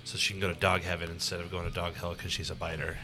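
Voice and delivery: ordinary delivery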